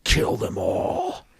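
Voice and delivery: slow, breath-heavy whispered command; hushed kill order. Acted. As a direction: whisper the order slowly, like a villain. President ordering the goblins killed.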